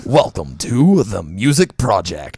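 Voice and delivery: slow, low trailer voice; movie announcer. Pretend. Guy taking his turn at the intro, movie announcer voice. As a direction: intone it low and drawn out. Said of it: "my movie announcer voice".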